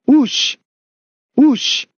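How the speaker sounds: two short high whooshes; sharp high "wush" effect; imitated whoosh. A narrator's reading. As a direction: voice a blade swiping through the air. Narrator imitates knife swings.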